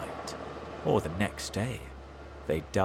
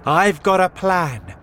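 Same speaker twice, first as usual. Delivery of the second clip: higher, louder announcement; shouted; 9 semitones higher, 11 dB louder than usual. A narrator's reading. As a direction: call out the plan to everyone. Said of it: "shouted Mister Fox".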